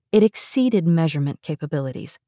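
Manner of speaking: ordinary delivery, nothing marked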